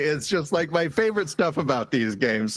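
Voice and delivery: usual speaking voice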